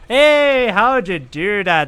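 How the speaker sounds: bright surprised welcome; delighted greeting. Real, not acted. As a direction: greet a viewer with a happy shout. Reacts to chat member.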